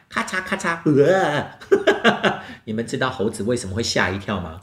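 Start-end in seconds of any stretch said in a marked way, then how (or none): 0.1-0.8 higher snappy 咔嚓咔嚓 shutter sound; camera click. Acted. Storyteller imitates the monkey's camera clicking. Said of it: "它这样子哦".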